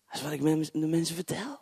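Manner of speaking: normal talking voice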